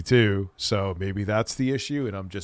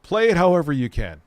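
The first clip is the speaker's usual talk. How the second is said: higher, louder catchphrase delivery; emphatic repeat; 7 semitones higher, 8 dB louder than usual. Shown as a demonstration. Streamer repeats the lawyer's way to say it, emphatically. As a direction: punch it out high and loud, falling at the end.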